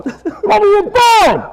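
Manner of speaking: high, slow, drawn-out holler; hollering from inside. Retold or imitated. Poor guy in the box hollers out, high and drawn-out. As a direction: imitate an impatient man yelling from a box. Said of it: "He's going".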